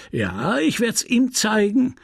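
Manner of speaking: high, slow, drawn-out menace; repeated threat. A narrator's reading. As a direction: gloat over the threat, repeating it. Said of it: "ständig wiederholend".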